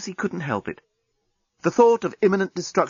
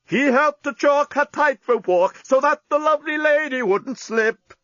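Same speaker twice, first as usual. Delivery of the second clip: much higher, far off sing-song bot voice; operatic gondola bot; 12 semitones higher than usual. Acted. Gondola robots serenading the grand canal, high operatic lilt. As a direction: croon it like a singing gondola robot.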